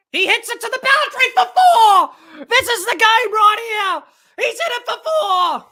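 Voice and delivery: high, loud shouting commentary; excited boundary call. Real, not acted. Commentator as Maxwell hits the boundary, excited shout. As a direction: shout the four as it happens.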